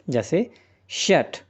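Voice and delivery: ordinary delivery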